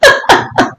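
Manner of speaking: airy, voiceless giggle; laughing out loud. A narrator's reading. Lady laughing out loud at her visitor, airily. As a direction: burst into short, airy laughter. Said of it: "laughed out loud".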